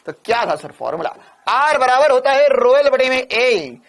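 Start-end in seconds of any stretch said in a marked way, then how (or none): 1.5-3.8 slow, drawn-out recitation of a formula; emphatic formula recital. Real, not acted. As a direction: stretch each term out for the class.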